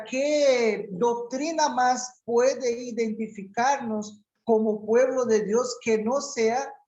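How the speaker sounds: ordinary talk, nothing marked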